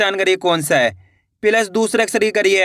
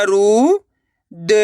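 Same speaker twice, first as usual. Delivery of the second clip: long drawn-out syllables with pauses between; much slower than usual. Shown as a demonstration. Teacher sounding out a word for learners, syllable by syllable. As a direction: sound out each syllable slowly and separately. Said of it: "एक बार फिर से देखिए".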